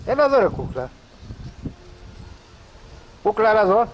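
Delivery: loud, high call to come here. Real, not acted. Owner calling the animal to come over, loud and high. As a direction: shout the pet's name across the yard.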